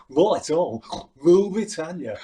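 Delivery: a lightly voiced Rick doing his smug cry; mild send-up of the cocky student; Rick Mayall. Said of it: "Like that, Rick and Vivian".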